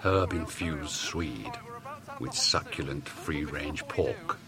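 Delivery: slow, soft, indulgent voiceover; luxury food ad voice. Acted. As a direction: purr it like a posh supermarket advert. Food ad parody.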